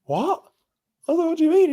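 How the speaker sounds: very high-pitched retold disbelief; incredulous. To a stranger who offered him acid, incredulous. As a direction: replay it as a squeaky, shocked question.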